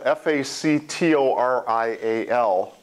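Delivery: "F A C T O R I A L" named letter by letter; letter-by-letter spelling of "F A C T O R I A L"; spelled out. Shown as a demonstration. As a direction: spell "F A C T O R I A L" one letter at a time. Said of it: "but it's spelled".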